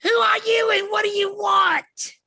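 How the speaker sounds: very high, loud demand; goblin shout. Acted. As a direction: bellow the challenge at the strangers. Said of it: "and she goes".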